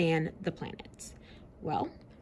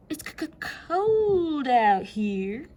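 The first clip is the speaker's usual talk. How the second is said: high slow stuttering shiver; high teeth-chattering voice; shivering Neptune; 7 semitones higher, 3 dB louder, much slower than usual. Acted.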